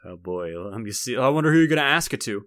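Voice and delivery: ordinary delivery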